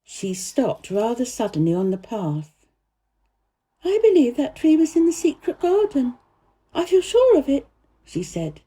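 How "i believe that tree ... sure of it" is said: put-on lightly higher girl's voice, earnest; a girl telling the gardener about the secret garden, higher voiced; young Mary voice. A narrator's reading. Mary's voice in the story.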